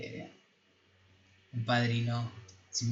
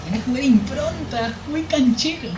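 parroted back much higher and louder, mocking; mock parroting; 10 semitones higher, 7 dB louder than usual. Parroting the film character back to a friend, mocking and loud. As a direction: throw his smug compliment back in a hammed-up voice.

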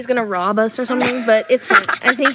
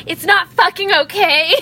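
snapping; 7 semitones higher, 6 dB louder than usual. Retold or imitated. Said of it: "totally bit their heads off".

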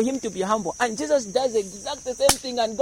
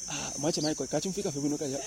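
quick, much softer muttered aside in Swahili; off-mic aside; 5 semitones lower, 10 dB quieter, faster than usual. Pretend. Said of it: "he forgets he switched on the mic".

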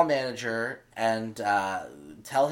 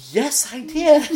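high answer through a laugh; giggling high agreement; 4 dB louder than usual. Retold or imitated. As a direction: laugh out the reply in disbelief. Answering pastor, laughing in disbelief.

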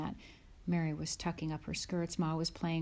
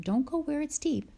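higher, louder mother's warning; Ma's caution; 9 semitones higher, 4 dB louder than usual. Acted. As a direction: call out to the wading girls. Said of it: "Ma told them".

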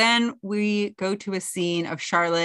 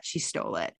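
a hushed, breathy whisper; whispered aside; 12 semitones lower, 8 dB quieter than usual. Retold or imitated. Carrie's voiceover about Lainey, whispered. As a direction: drop to a whisper like sharing a scandal.

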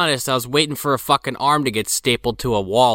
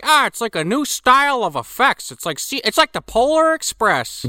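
mocking voice; 12 semitones higher, 3 dB louder than usual. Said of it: "you're like".

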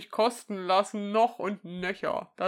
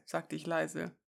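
normal talking voice

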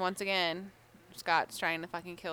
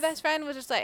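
ordinary talk

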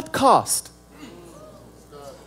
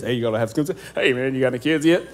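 nosy guy; 12 semitones lower, 7 dB quieter, much faster than usual. Retold or imitated. People asking about kids.

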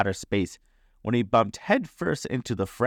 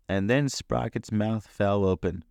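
usual speaking voice

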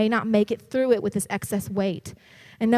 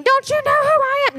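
higher, louder mock rebuke; mock yelling; 12 semitones higher, 4 dB louder than usual. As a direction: ham up a yelling boss.

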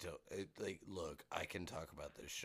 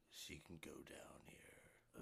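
gravelly voice; 2 semitones lower, 14 dB quieter, slower than usual. Shown as a demonstration. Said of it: "really good gravelly voice".

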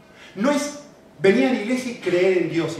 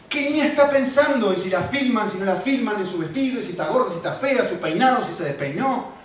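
loud, rising sermon delivery; fervent preacher building to a peak; impassioned preaching; 4 semitones higher, 4 dB louder than usual. Acted. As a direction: preach it with fire to the congregation. Preacher's story at full force.

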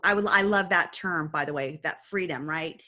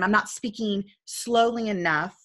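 normal talking voice

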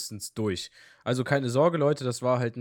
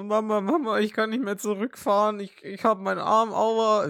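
put-on higher, louder whimpering child calling mum; hurt boy whining to his mum on the phone; whiny kid voice; 7 semitones higher, 4 dB louder than usual. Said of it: "und dann war ich so".

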